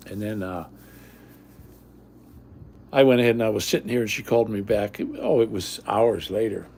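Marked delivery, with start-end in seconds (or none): none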